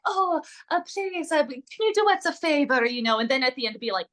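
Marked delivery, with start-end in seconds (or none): 0.1-2.8 playful fake Italian accent, pitched higher; lightly done Italian accent asking a favor; mock Italian accent. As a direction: put on a hammy Italian accent to a stranger. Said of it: "in character in our Italian accents".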